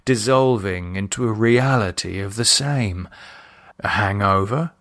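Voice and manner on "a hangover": ordinary delivery, nothing marked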